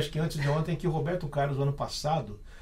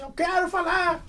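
much higher, slow mock old-woman voice; lady pastor taking the microphone, high mock voice; imitating old lady pastor; 12 semitones higher, slower than usual. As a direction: ham up a stern church lady. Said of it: "Ela levantou e falou assim".

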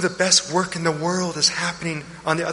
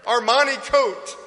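excited joyful father; 10 semitones higher than usual. Acted. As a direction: throw a party for the lost son. Father celebrates son's return.

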